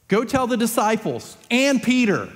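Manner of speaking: high, pressing "and Peter"; emphatic AND PETER. Acted. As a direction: drive home "and Peter" after a beat. Repeats the message, stressing Peter.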